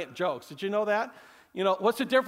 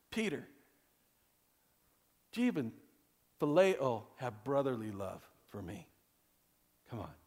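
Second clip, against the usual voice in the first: soft plea; 8 semitones lower, 7 dB quieter than usual. Acted. Jesus pleading softly with Peter.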